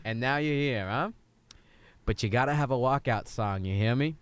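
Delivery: put-on Southern accent. Coach lecturing his player about walkout songs, lightly Southern. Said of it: "I keep going Southern".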